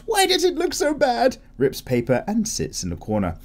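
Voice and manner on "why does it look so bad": high squeaky despair; whiny high wail. Acted. Acted comment, artist despairing.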